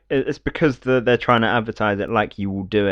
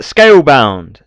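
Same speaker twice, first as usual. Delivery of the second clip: loud, higher-pitched title shout; mock title announcement; 4 semitones higher, 11 dB louder, much slower than usual. Mocking the trailer's sudden title reveal, loud announcement.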